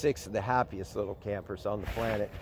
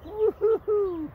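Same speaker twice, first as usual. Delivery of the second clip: much higher, slow silly woo-hoo; playful hoot; 3 dB louder, slower than usual. Real, not acted. Hooting at the dogs like a cuckoo.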